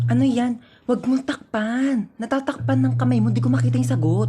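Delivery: soft exam-room undertone; furtive hushed voice. Acted. Student copying from a seatmate in an exam, furtive hush. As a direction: keep it down so nobody catches you.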